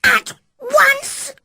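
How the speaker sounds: put-on high, loud furious boy's yell; boy's shout. Sick boy shouting at Mary to get out. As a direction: yell it at her as you hurl the book. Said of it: "he shouted".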